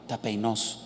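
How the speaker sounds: low, soft "tapeinos", stressed on the O; quiet Greek "tapeinos" with final stress. Shown as a demonstration. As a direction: repeat "tapeinos" quietly, accent on the last syllable. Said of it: "O con acento S".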